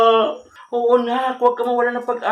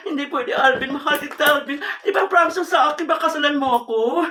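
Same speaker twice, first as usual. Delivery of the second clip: raised, agitated begging into the phone; desperate pleading; 3 semitones higher than usual. Acted. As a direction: beg a lover not to leave. Pleading on phone while being dumped.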